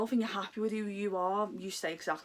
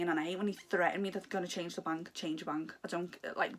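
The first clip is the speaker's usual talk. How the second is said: ordinary talk, nothing marked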